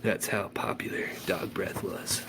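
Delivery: soft whispered deadpan; whispered punchline. A narrator's reading. Narrator delivering the punchline about the band, soft whisper. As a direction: whisper the joke dry.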